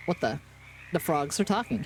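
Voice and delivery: usual speaking voice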